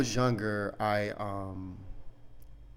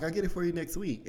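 higher, fast, putting it off; offhand dad promise; 8 semitones higher, faster than usual. Retold or imitated. Dad stalling his kid, higher and offhand. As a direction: brush it off till next week. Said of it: "And then he's like".

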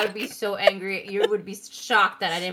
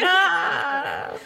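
high mock-tearful wail; on the verge of crying; 7 semitones higher than usual. Answering Kelly's are you okay, mock tearful wail. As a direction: whimper it out, imitating near tears. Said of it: "on the verge of, of crying".

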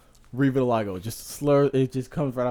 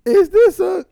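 very high, loud mock outburst; hammed-up outrage with gaps for censoring; imitating bleeped video; 12 dB louder, much slower than usual. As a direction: shout it high, breaking into laughter. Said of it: "It just be like".

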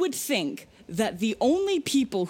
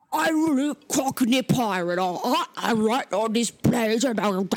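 put-on cockney pirate accent; slower than usual. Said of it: "I'll do Ben Johnson".

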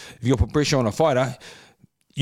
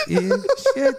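ordinary talk

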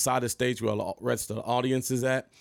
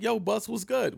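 much higher, eager mock greeting; nervous younger self; 10 semitones higher than usual. Greeting [name] in the alley, higher nervous voice. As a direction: play his starstruck younger self. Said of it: "And I'm like".

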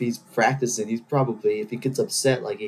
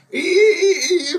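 much higher, loud, strained cartoon cry of evil; host doing a cartoon hero's loud cry of evil; Mermaid Man; 6 dB louder than usual. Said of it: "Mermaid Man for you from SpongeBob".

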